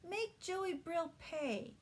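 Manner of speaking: put-on high, slow, indignant little girl. A narrator's reading. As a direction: demand it like an offended child. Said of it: "Holly said indignantly".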